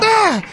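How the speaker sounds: sudden high-pitched shriek; high scream. Acted. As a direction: shriek in fright. Host screams at scare.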